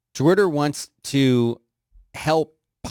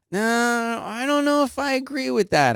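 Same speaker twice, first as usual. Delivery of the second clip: much higher put-on voice; prissy mock objection; 11 semitones higher than usual. Put-on voice reaction.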